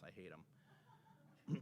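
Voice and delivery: a fake-bitter aside, barely audible; mock whispered grudge. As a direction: whisper it very softly as a slow joke. Jokes about the well-loved Freddie.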